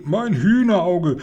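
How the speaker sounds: high, drawn-out narrated yelp; mild pained cry. A narrator's reading. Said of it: "und schrie".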